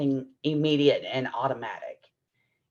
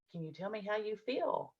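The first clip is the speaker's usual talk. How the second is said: much softer, higher, coaxing ask; gentle question; 4 semitones higher, 11 dB quieter than usual. Retold or imitated. Teacher gently asks the upset child, much softer. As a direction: soften right down to coax the child.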